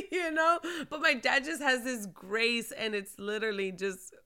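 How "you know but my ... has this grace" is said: usual speaking voice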